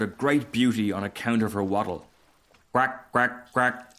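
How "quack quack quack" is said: a light storybook quack; lightly voiced duck call.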